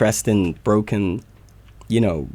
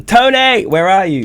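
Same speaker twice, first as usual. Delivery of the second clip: excited calling out; 8 semitones higher, 6 dB louder than usual. Real, not acted.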